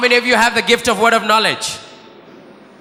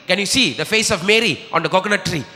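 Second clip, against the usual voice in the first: ordinary delivery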